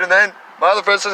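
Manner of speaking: usual speaking voice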